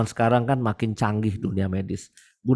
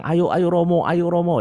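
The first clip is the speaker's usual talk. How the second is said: imitating eager supporters, higher and louder; semangat mendesak; 7 semitones higher, 3 dB louder than usual. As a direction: cheer him on like a fan. Supporters urging priest to run. (meniru pendukung yang bersemangat)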